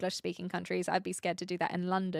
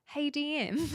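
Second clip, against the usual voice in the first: higher Kiwi-sounding greeting; botched Aussie accent, more like Kiwi; attempted Aussie accent, landing Kiwi; 6 semitones higher than usual. Shown as a demonstration. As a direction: try Aussie and slip into Kiwi. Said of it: "No, that was Kiwi".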